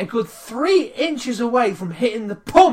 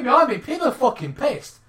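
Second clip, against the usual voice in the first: usual speaking voice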